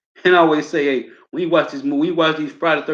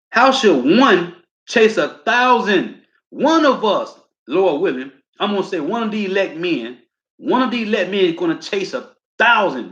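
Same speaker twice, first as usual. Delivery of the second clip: preaching at full force; 6 semitones higher than usual. Acted. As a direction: lean into it like a pulpit climax. Preacher's rhetorical build.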